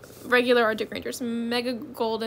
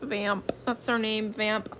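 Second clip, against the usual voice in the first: ordinary talk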